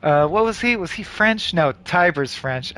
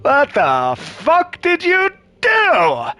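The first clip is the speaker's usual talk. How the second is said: slow, higher furious demand; angry villain; 5 semitones higher, 3 dB louder, much slower than usual. Acted. Xanadu confronts the party, slow furious demand. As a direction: bite out each word in rage.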